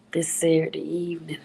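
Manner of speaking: ordinary talk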